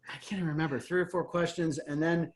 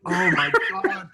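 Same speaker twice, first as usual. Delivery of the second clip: laughing disbelief; 4 semitones higher, 6 dB louder than usual. Retold or imitated. Aghast reaction, retold.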